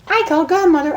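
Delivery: high chirpy singsong; high Pinkie singsong. A narrator's reading. Pinkie singing into Rainbow's ear, high singsong. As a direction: sing it high and teasing. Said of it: "sang into her friend's ear".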